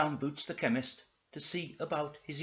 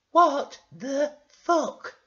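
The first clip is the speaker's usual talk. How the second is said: slow, high falsetto shock; each word drawn out in girly falsetto; stunned, high-pitched character; Florence; 13 semitones higher, 10 dB louder, much slower than usual. Acted. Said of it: "said Florence".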